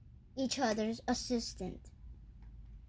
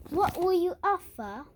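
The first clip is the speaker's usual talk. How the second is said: playful British accent, higher pitch; 7 semitones higher, 5 dB louder than usual. Shown as a demonstration. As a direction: ask it like a posh Brit. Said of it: "I'm gonna do a British accent now".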